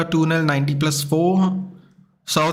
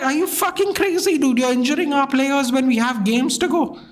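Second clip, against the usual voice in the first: much higher, heated retold telling-off; furious rant; 6 semitones higher than usual. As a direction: scold the player in disbelief. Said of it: "I actually got mad".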